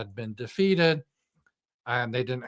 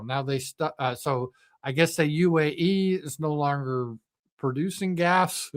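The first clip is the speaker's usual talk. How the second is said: usual speaking voice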